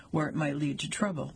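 usual speaking voice

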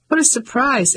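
mild surprise. A narrator's reading. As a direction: lift the pitch in mild surprise. Ellie reacts to fellow countrywoman.

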